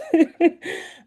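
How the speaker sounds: short high nervous laugh. Real, not acted. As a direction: let out an embarrassed little laugh. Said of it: "I'm a little nervous".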